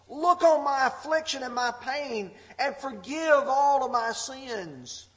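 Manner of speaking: high, loud pleading scripture reading; impassioned plea. Acted. Preacher voicing David begging God for forgiveness, impassioned plea. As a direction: cry out the prayer, easing off at the end.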